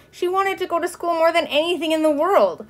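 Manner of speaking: high, insistent narration; heartfelt emphasis, pitched well up; fervent longing. A narrator's reading. Repeat heightened, more than anything.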